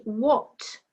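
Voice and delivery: what, H left out; what with silent H. Shown as a demonstration. Teacher goes through the ten words, what with silent H. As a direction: model what without any H sound. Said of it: "silent H".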